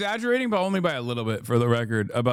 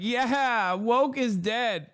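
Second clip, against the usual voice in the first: mock giddy Megyn Kelly; 8 semitones higher, much slower than usual. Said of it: "And Megyn Kelly's like".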